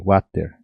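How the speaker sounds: "water" as gwa-ter with a light g onset; Paraguayan water. Shown as a demonstration. Teacher imitating how Paraguayans ask for the water: gwa-ter. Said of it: "a pessoa vai falar assim".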